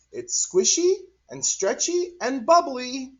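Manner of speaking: put-on very high, delighted kid; child voice. Cory describing the gloop, high child voice. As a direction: list it gleefully like a little girl. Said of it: "Cory is over here saying".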